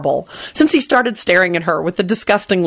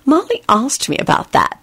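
smug; 7 semitones higher than usual. A narrator's reading. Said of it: "she told him smugly".

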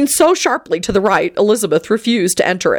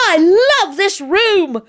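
shouting; 11 semitones higher than usual. A narrator's reading. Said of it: "Teddy shouted".

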